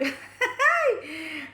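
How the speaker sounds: loud, high giggling burst; burst of laughter. Real, not acted. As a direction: break into a real laugh. Laughing while eating.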